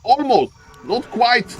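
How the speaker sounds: ordinary talk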